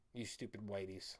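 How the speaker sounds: low, quiet sneering put-on voice; a hint of a mocking caricature; mock accuser voice.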